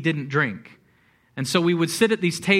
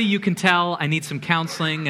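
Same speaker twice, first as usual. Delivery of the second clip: usual speaking voice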